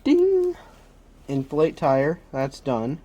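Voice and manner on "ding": high loud ding; chime sound. Pretend. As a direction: sing out a bright ding. Checking items off list.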